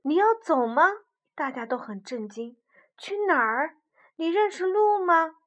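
high, loud shocked questions. Acted. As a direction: ask in dismay.

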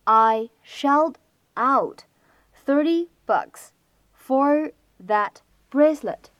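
very slow, each chunk spaced with pauses; slow teaching read with pauses between phrases; slow word by word. Shown as a demonstration. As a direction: break the line into slow careful chunks. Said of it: "注意一下以下的这样的一些发音技巧".

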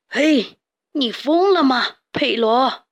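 put-on old rooster yelling in alarm; grandpa shouting. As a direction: shout at him to snap out of it. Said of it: "公鸡爷爷大喊".

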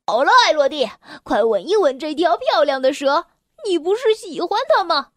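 high, teasing sing-song jeer; mocking taunt. A narrator's reading. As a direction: push the snake at her with a mean grin. Bully taunts girl with snake.

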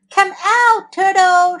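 high, loud shout; loud high summons. A narrator's reading. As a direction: call the hider out of his shell. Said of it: "shouted Beaver".

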